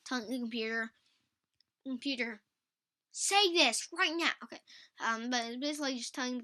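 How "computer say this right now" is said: louder, higher, drawn-out order; commanding. Acted. Host plays a user bossing the computer, loud command. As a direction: order the machine about, loud and emphatic.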